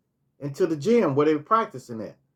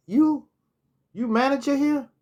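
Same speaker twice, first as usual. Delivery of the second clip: quoting him much higher in pitch; imitating him; 11 semitones higher than usual. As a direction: ask it in his voice, pitched well up. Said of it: "And he said".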